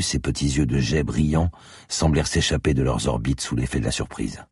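plain reading voice

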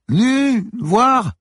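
very high, isolated shouts; straining, loud, high calls; word-by-word yelling. A narrator's reading. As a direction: yell each word separately to be heard. Repeats key words one by one.

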